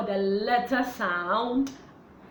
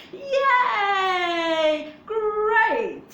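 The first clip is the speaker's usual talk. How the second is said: high excited cheer; 8 semitones higher, 4 dB louder than usual. Acted. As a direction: cheer them on, bright and high. Teacher cheering for the children.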